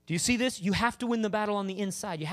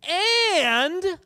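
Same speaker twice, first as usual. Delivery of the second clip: bold shout; 13 semitones higher, 8 dB louder than usual. Acted. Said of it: "And then he said".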